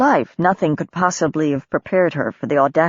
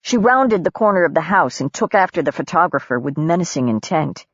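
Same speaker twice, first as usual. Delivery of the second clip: usual speaking voice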